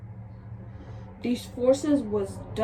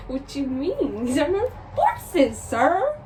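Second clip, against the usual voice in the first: getting mad; 5 semitones higher, 4 dB louder, much slower than usual. Retold or imitated. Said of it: "I was kinda getting mad".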